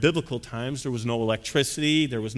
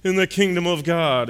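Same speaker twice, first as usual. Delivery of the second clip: affluent guest's clenched-teeth drawl; pompous well-to-do man, drawn out at the end; Thurston Howell III. Acted. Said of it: "might have had a voice similar".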